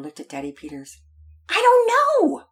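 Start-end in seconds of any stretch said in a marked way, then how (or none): 1.5-2.4 put-on high, loud little-girl voice; children answering together. A narrator's reading. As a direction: chirp it loudly like children. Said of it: "they answered in unison".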